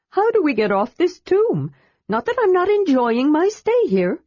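high, bright kender voice; eager kender. A narrator's reading. Kender questions the dragon, high and eager. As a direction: pitch it up like a curious kender.

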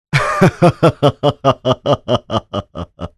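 long, high, evenly pulsed laugh falling in pitch; high staccato cackle. Acted. Cat laughing along at the joke, high cackle. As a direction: laugh in a high, rapid string of ha-ha-has.

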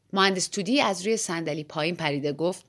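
plain reading voice

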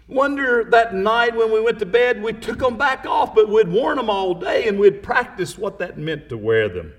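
loud, high, fervent preaching; preaching at full force. Acted. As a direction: preach it out with fire. Preacher building to fervor.

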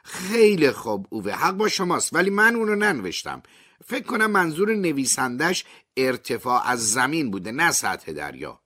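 lightly voiced high reporter, forced patience; patient journalist. A narrator's reading. Journalist steadies herself to answer Ove, lightly voiced high. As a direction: explain it high and strained. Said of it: "نفس عمیقی کشید و گفت".

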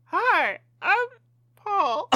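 high hooting monkey squeak. Pretend. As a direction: pitch way up like a silly monkey. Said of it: "we all have to do the monkey voice".

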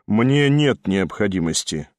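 cold dismissal; curt cut-off. A narrator's reading. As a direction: shut him down flatly. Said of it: "отрезал Ставрогин".

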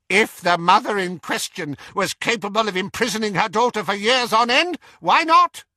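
quick, high aunt retort, rising to a loud finish; shrugging it off. A narrator's reading. Auntie Muriel answering Doge's outrage, brisk and dismissive. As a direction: rattle it off, ending sharply high. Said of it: "shrugged Auntie Muriel".

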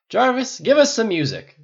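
put-on loud, high drunken order; demanding. A narrator's reading. Tony ordering music from his AI butler, demanding. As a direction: order it at full voice. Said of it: "Tony demanded".

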